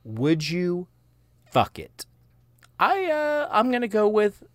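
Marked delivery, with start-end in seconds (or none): 2.8-4.4 excited. Real, not acted. Hyping up his wild pick.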